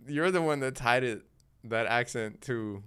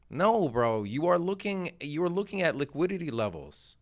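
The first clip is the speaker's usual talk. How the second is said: ordinary delivery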